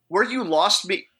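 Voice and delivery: ordinary delivery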